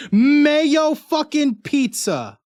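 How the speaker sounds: high, drawn-out exclamation; mock-dramatic readout. Streamer reading the letter's P S S to chat, high drawn-out exclamation. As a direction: stretch each word high, then drop at the end. Reads out letter's running-joke postscript.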